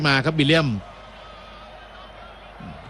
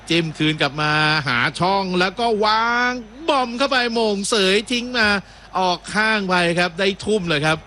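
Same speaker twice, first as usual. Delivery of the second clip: higher-pitched match call as the attack builds; live play-by-play; 6 semitones higher than usual. Real, not acted. Commentator calling a through ball to viewers, raised play-by-play voice.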